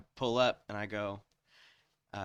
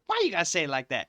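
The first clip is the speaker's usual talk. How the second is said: louder, higher imitation of a worker; indignant drive-thru lady; 7 semitones higher, 7 dB louder, faster than usual. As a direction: challenge the customer, pitched up and loud. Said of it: "she goes".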